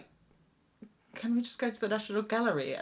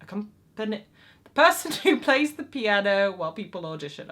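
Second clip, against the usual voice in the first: ordinary delivery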